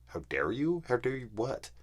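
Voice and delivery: high, incredulous mimicry; mocking, high-pitched indignation; mock outrage.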